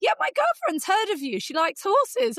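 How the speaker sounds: high, light mock-teen voice; imitating offhand nephew. Nephew tells his aunt, with a lightly voiced teen shrug. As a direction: toss it off like an unimpressed teen.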